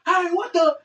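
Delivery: high-pitched startled yelp; startled exclamation. Retold or imitated. Son turning round to find his dad gone, startled.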